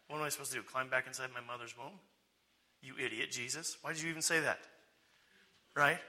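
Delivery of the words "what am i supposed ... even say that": soft, muttered mock disbelief; hushed, incredulous acted retort; Nicodemus. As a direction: grumble to yourself, baffled and dismissive. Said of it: "what was Nicodemus's response?".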